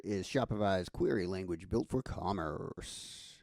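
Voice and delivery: ordinary talk, nothing marked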